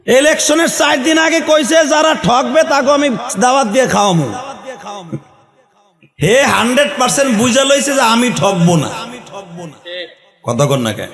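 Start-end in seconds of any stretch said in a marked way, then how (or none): 0.1-8.9 high, forceful sermon delivery; high-pitched oratory to a crowd; impassioned preaching. Real, not acted.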